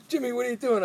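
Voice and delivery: teacher finds books across hallway, startled; surprised teacher. Retold or imitated. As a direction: catch the kid mid-prank. Teacher catching prank.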